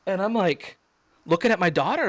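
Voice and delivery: usual speaking voice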